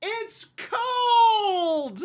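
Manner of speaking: very high, loud, drawn-out howl; mocking wail. Worker parroting the customer's complaint, hammed-up wail. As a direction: wail it in exaggerated outrage.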